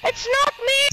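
high-pitched, indignant outcry; indignant protest. Real, not acted. Accused player defending himself to teammates, indignant protest. Denies blame in game chat.

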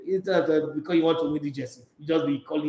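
normal talking voice

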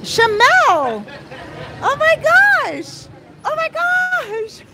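high, drawn-out squeal of delight; excited squeal. Real, not acted. Vlogger spotting her friend Shamel, high excited squeal.

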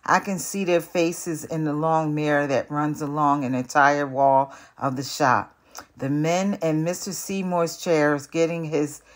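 usual speaking voice

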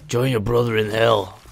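mock epic hero line; a lightly put-on epic action-hero voice. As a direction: deliver it like a movie hero. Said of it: "in his most epic voice".

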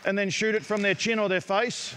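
projecting to the gym. Real, not acted. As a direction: call it out so the coaches hear over the balls.

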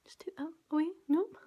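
soft whispered aside; whisper to self. Real, not acted. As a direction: whisper while concentrating. Muttering to herself mid-fix.

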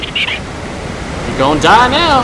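drawn-out threat. Pretend. Taunting creature in game.

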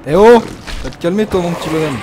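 loud yell at an enemy; shouting. Real, not acted. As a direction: shout him down. Yelling at enemy in game.

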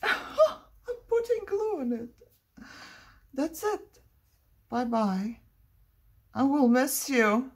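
high, whiny pretend sorrow; mock tearful farewell. Crafter says goodbye to glued paper, mock tearful. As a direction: wail a hammed-up goodbye.

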